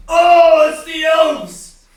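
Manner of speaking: very loud, high cry of delight; hammed-up host greeting visitors; excited greeting. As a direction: shout a drawn-out, overjoyed welcome. Character greets arriving visitors.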